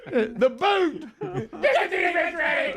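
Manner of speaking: very high screeched yell, half laughing; imitated scream. Reprising the head coach's reaction for laughs, screamed. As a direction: shriek it like a panicking coach. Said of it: "he just screams out".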